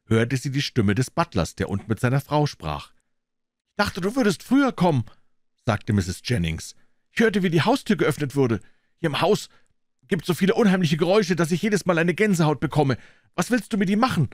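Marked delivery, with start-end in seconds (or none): 3.7-5.1 high-pitched wife voice; higher, fretful woman talking to her husband; butler's wife. A narrator's reading. 7.1-14.3 high-pitched, uneasy wife voice; quick, higher woman's voice confiding her unease; butler's wife. A narrator's reading.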